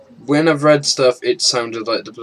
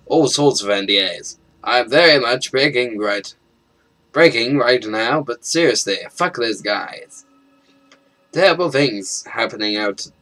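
reading a leaked letter with urgency; tense, hurried letter read aloud; dramatic note reading; 2 semitones higher, 9 dB louder than usual. Acted. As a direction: read it like a nervous whistleblower. Reads in-game note aloud.

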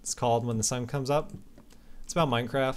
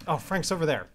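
normal talking voice

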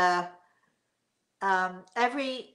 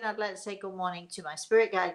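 ordinary talk

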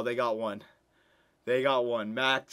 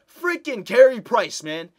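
higher, louder, slow exasperated cry; frustrated outburst; 8 semitones higher, 10 dB louder, slower than usual. Real, not acted. Gamer venting loudly at the rival goalie. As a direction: vent at the unbeatable goalie.

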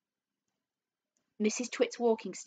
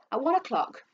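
usual speaking voice

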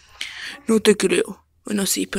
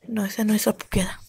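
whisper; 4 dB quieter than usual. Acted. Whispered voice held.

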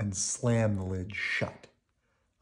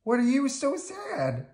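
very high, concerned puppet voice; childlike bird voice; Big Bird; 4 dB louder than usual. A narrator's reading. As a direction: ask a friend with high-pitched concern. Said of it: "Big Bird called".

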